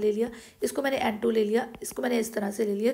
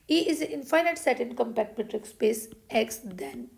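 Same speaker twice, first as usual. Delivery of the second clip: slow dictation; much slower than usual. Shown as a demonstration. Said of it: "dictating theorem slowly".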